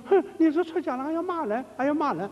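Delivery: put-on high indignant young man's voice; outraged young man. Monk acting the young man shocked at the master's insult, high outrage. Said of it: "young man outraged at being scolded".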